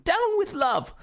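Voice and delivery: high loud heckler's voice; Witherspoon's high heckling cry; heckler's protest. Acted. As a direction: shout it down from the floor. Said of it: "said Witherspoon".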